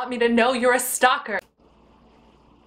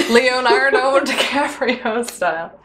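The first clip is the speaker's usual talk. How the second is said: laughing; 5 semitones higher, 4 dB louder, slower than usual. Real, not acted. Mock romantic reference, laughing.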